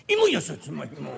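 loud, drawn-out raw sweet potato call; hard vendor's cry. Acted. Vendor hawking raw sweet potatoes, sounding as hard as they are. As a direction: cry it out stiff and hard, loudly. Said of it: "硬いよと硬そうに売ったもんです".

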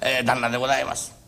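low, unhurried rakugo narration; storyteller narration. A narrator's reading. Teller describing the contrary master to the audience, low and calm.

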